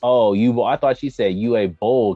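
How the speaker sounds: ordinary talk, nothing marked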